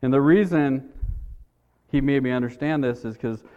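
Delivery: ordinary delivery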